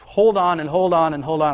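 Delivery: ordinary talk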